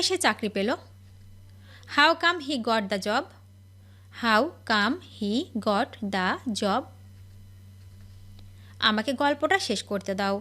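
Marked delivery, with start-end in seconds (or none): 4.2-6.8 slow, clear repeat. Shown as a demonstration. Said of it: "teacher model then slow repeat".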